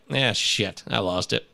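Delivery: ordinary talk, nothing marked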